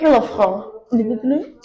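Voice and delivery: drawn-out Villafranche in a hammed-up French accent, slow; mock French accent. Shown as a demonstration. Trying to say the town the French way, in a mock French accent. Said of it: "I can't do the French accent".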